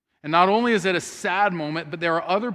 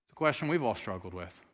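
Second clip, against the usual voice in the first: ordinary talk, nothing marked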